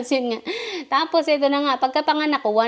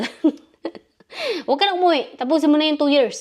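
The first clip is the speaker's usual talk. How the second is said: mock scolding boss, louder and higher; angry employer; 6 semitones higher, 4 dB louder, faster than usual. Employer scolding her not to go home, angry retold yell. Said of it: "nagagalit sa akin".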